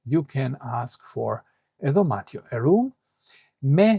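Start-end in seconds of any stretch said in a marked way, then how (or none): none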